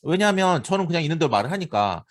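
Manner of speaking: normal talking voice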